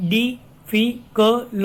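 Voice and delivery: "difficult" very slow, di - fi - cuh - luh - tuh; syllable by syllable. Shown as a demonstration. Teacher counting five syllables, "difficult" drawn apart piece by piece. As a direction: split "difficult" into five evenly spaced pieces. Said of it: "again we have five syllables".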